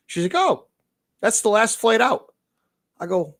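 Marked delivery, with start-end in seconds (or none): 0.3-2.3 high, chirpy woman's voice; imitating bartender. Quoting the airport bartender telling them it's the last flight, in a high voice. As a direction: put on the bartender's surprised chirp.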